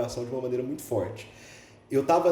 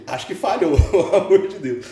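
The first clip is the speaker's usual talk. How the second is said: laughing it off; 4 semitones higher, 10 dB louder than usual. Retold or imitated. Retreat where God's love skipped him.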